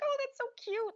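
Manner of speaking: high-pitched aww reaction; imitated cooing. Viewer watching the hair braiding, imitated coo. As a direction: coo it like a melting onlooker. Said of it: "and you're like".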